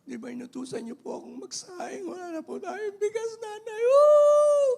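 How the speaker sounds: high-pitched tearful wail, hammed up; mock crying child. Child telling mother the rice is gone, hammed-up crying. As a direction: whine it, then burst into a loud wail. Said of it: "Umiyak siyang pumunta kay nanay".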